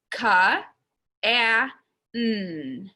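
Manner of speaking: "Ka, a, n." as three separate phonics sounds; sounding out. Shown as a demonstration. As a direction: sound out "Ka, a, n." with short a and held n. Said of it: "I'm gonna sound it out".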